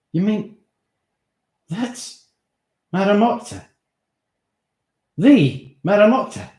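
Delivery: shocked. Acted. Steve shocked realisation.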